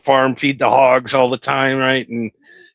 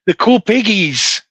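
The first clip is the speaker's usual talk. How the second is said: lightly voiced little kid, a bit higher; childlike voice; 5 semitones higher than usual. Boy recalling his grandpa's pigs in a light childlike voice. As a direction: coo it like a small child fond of the piggies. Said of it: "a nine-year-old kid".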